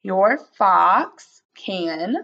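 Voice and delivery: very slow, each word stretched and separate; drawn-out teacher read-back; slow rereading. Shown as a demonstration. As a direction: read it word by word for young writers. Teacher rereads slowly while writing.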